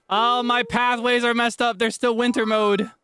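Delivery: high-pitched panicked shrieking; loud high wailing, near crying; screaming. Real, not acted. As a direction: shriek in dismay at the ruined room. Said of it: "so about not screaming".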